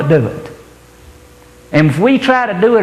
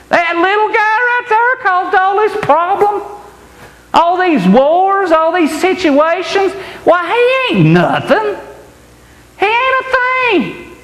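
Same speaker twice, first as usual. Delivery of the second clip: high, scornful sneer, drawn out; Satan laughing down at a small man, mocking; 13 semitones higher than usual. Satan scoffing at the little believer, high mocking voice. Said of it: "Satan, you're gonna say".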